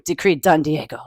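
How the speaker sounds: ordinary delivery, nothing marked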